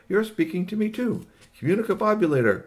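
a hint of a wondering visitor; lightly voiced alien.

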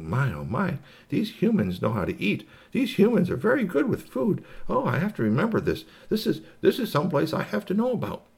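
high-pitched marvelling alien character; impressed alien. Acted. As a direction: think aloud in amazed high voice. Alien's admiring thoughts.

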